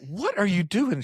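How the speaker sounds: high-pitched mock disbelief; baffled question. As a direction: replay your astonishment, pitched well up. Retold baffled question to HR rep.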